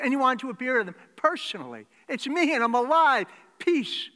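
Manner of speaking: loud, high, driving sermon voice; preaching at full force. Acted. Preacher to congregation, the risen Jesus greeting them, loudly. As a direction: proclaim it to the back row.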